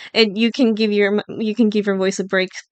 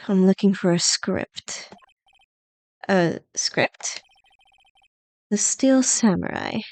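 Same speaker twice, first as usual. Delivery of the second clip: cold producer voice; 2 semitones lower, slower than usual. Acted. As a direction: murmur it like a distant diva. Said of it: "should I voice her".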